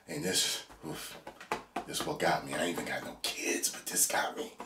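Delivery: very low, hushed and moved; close to tears. Real, not acted. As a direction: hold back tears, almost whispering. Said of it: "I'm not gonna cry".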